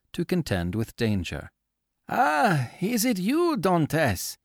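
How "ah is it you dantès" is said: high, eager call from a skiff; cried out. A narrator's reading. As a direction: hail him from the water, the older shipowner's voice. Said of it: "cried the man in the skiff".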